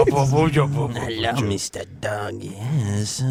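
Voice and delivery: put-on quirky old shopkeeper voice for the dog. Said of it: "sounds like an old man who's a shopkeeper".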